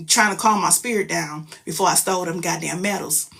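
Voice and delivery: usual speaking voice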